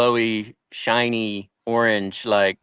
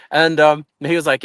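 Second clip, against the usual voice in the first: ordinary talk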